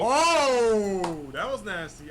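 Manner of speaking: high, loud shocked "oh"; surprised. Real, not acted. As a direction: react to a brutal move. A sudden brutal move in the game.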